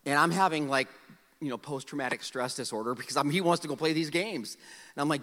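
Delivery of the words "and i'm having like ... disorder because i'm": ordinary delivery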